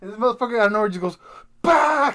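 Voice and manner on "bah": put-on high, loud "Bah!" of a kid attacking; mock battle cry. Dad imitating his son's attack yell, loud and high. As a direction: yell it like a four-year-old ambushing.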